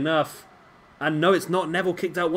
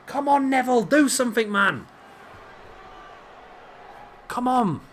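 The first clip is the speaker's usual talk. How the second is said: raised urging; 9 semitones higher than usual. Real, not acted. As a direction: urge him on with rising pitch.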